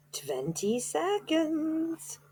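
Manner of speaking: very high, very slow witch voice; witch counting down. A narrator's reading. Grand High Witch counting down to Bruno's change, high and drawn out. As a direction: drag it out like a gleeful witch. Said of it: "cried the Grand High Witch".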